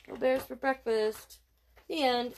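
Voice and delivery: plain reading voice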